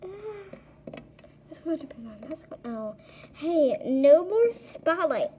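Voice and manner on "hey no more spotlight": loud, slow play-scolding; mock scolding toy. Kid pretending a toy is scolding another, loud and slow. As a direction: boss the toy around in play.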